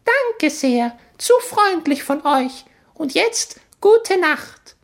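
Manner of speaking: very high, squeaky smug tiny voice; clever baby voice. Acted. Baby in the suitcase boasts to the boys, very high. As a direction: brag like a know-it-all infant.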